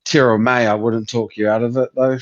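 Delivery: usual speaking voice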